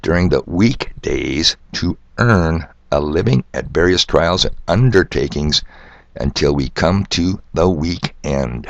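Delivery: slow, deliberate reading, leaning on "awake", "morning" and "weekdays"; stressed key words. Shown as a demonstration. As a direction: draw out and weight "awake", "earn", "undertakings" and "weekend". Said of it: "try to stress the words".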